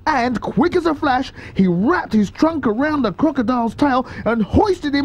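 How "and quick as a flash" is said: plain reading voice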